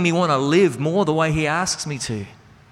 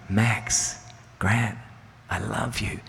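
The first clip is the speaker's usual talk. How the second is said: low, much softer, hushed; gentle whisper; 6 semitones lower, 7 dB quieter, slower than usual. Retold or imitated. Father voicing God's whisper to his son in the woods, hushed. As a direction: murmur it like a breeze in the treetops. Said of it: "I think I hear God whispering to us".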